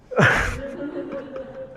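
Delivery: high-pitched laugh; laughing at own joke. Real, not acted. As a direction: burst into a real, high laugh. Laughs after joke about drinkers.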